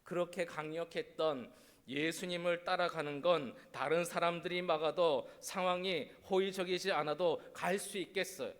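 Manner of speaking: normal talking voice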